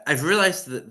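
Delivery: ordinary talk, nothing marked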